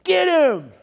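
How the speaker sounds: high, loud shout to attack; hammed-up cheer from a buddy. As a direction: yell it to egg him on. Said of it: "Bill goes".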